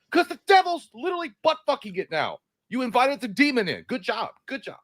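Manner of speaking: high, exasperated comic rant; sarcastic high-pitched telling-off; mock scolding. Mock answer to the complainers.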